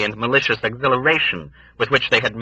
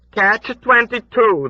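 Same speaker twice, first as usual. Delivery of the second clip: put-on high, slow old woman's reply; 5 dB louder, slower than usual. A narrator's reading.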